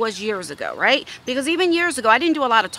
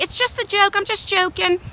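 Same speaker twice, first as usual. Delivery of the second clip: placating daughter; 9 semitones higher than usual. Retold or imitated. Daughter placates angry mother.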